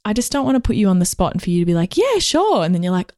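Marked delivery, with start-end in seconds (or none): 2.0-2.6 much higher, startled "yeah, sure"; caught off guard. Retold or imitated. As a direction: blurt it out surprised. Imitated caught-off-guard reply.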